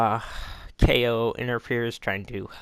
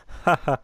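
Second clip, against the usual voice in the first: quick amused laugh; a brief burst of laughter; short laugh; 5 semitones higher, 3 dB louder than usual. Real, not acted. Laughs at own slip.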